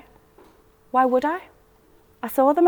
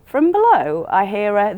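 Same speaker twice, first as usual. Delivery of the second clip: plain reading voice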